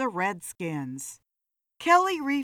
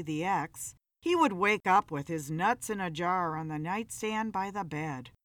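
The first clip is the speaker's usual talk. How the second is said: ordinary delivery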